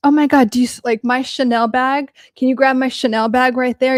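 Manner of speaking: put-on, showy gushing; imitated show-off friend. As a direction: imitate a superficial woman flaunting designer things. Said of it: "one of the things she would do".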